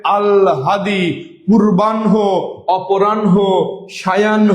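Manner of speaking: আল হাদি, পূর্বাহ্ন, অপরাহ্ন, সায়াহ্ন, each with audible h; correct pronunciation. Shown as a demonstration. Teacher recapping the right forms, correct pronunciation. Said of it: "যখন বলবো তখন হবে".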